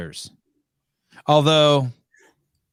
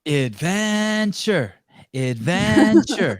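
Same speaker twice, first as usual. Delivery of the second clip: slow, drawn-out high announcer word; mock adventure pitch; 5 semitones higher, much slower than usual. Co-host hamming up smugglers' sales pitch, slow drawn-out. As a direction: stretch it like a trailer voice.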